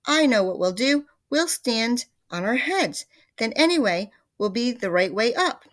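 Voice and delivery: announcing his clever plan; excited Mr Twit. A narrator's reading. Mr Twit's plan.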